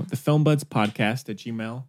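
ordinary delivery

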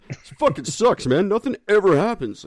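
high mock whine of boredom; bored gang member. Bored gang on old couch.

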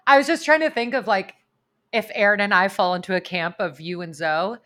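ordinary talk

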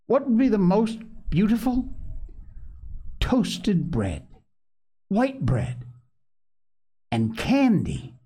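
high-pitched, savouring each treat; eager. A narrator's reading. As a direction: list treats with eager wonder. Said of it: "looked up eagerly, his answer all ready".

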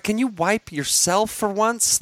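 exasperated parent. Retold or imitated. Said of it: "I'm like".

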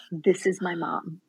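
hushed emotional reveal. Retold or imitated. Showing husband her birth mom.

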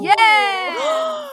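high, loud shout of triumph; triumphant yes. Real, not acted. Guesser cheers as the riddle is solved, triumphant. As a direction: cheer the correct guess loudly.

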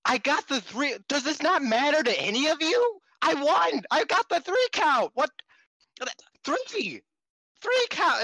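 mock a tantrum, high and loud; upset Mayu mockery. Podcaster mimics Mayu insisting on her win, high whiny outburst. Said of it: "Mayu gets really upset".

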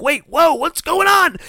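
very high surprised questions; startled confusion. Retold or imitated. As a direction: gasp in alarm.

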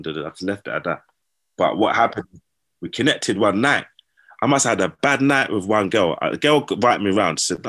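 ordinary delivery

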